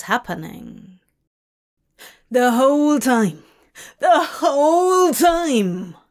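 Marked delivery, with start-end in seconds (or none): 2.3-6.0 loud, higher anguished wail. A narrator's reading. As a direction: cry it out in despair. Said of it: "Crowley wailed".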